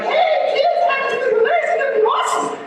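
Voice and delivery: mock-cheerful parent hyping up little kids at Disney; chirpy parent. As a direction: gush like a dad promising the best day ever. Cheery parent at park gate.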